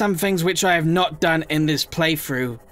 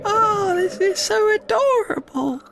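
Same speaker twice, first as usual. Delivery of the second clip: much higher, slow gushing coo; slower than usual. Real, not acted. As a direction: melt over how cute they are. Cooing at the babies again.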